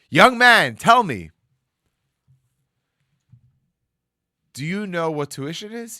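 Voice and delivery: loud, high stern question; stern rabbi. Retold or imitated. Rabbi questioning the boy about tuition, loud and stern. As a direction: press the boy like a stern elder.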